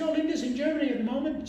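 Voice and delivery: high, small mock-innocent voice; timid fibbing. Employee lying to his boss about his wife, high and meek. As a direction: pitch it way up, nervously excusing.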